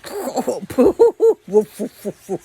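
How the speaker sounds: loud, high mocking dog laugh. Stray dogs laughing at the dog who obeys his boy, loud mocking laugh. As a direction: laugh him off, scornful. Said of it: "They both just started laughing".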